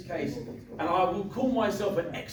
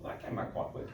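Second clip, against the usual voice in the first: quiet retold thought; 9 semitones lower, 7 dB quieter than usual. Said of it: "and thought".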